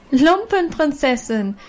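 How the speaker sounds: high, drawn-out taunting call; mocking call. A narrator's reading. Schoolgirls jeering at the girl in rags, taunting. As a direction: sing it out to mock her. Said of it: "riefen die anderen und lachten".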